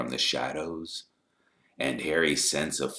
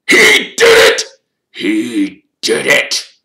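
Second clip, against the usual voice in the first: very high, loud, furious outburst; spat accusation; 10 semitones higher, 17 dB louder, slower than usual. A narrator's reading. Caretaker blaming Harry for his cat, spitting with rage. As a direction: spit the words out in fury, slower the second time. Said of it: "Filch spat".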